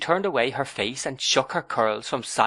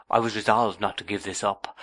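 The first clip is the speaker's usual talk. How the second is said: plain reading voice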